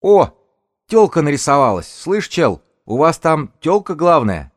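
loud, high, cocky jeer; jeering shout. Acted. Young lookout jeers at the woman leader, high loud shout. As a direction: holler mockingly at the other side.

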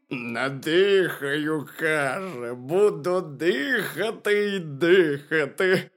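loud, very slow bear retelling; slow bear mimicry, rising and loud; bear quoting hare. A narrator's reading. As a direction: draw it out, imitating a stubborn hare.

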